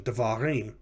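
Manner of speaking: ordinary talk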